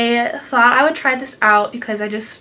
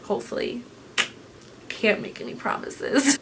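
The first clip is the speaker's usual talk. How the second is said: sheepish whisper; whispered aside; 9 dB quieter than usual. Real, not acted. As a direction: whisper it, half joking.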